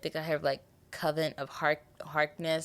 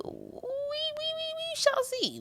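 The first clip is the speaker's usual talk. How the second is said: high mock ominous; 3 dB quieter, slower than usual.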